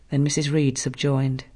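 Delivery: ordinary delivery, nothing marked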